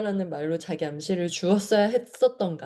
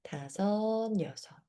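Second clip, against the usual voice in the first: much softer, hushed count; soft exhale count; 7 dB quieter, much slower than usual. Shown as a demonstration. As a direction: count the exhale gently, almost breathing it. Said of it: "육 초 내쉬기".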